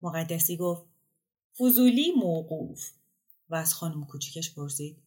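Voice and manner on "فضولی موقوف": scolding. Acted. Host cuts off a guest's prying.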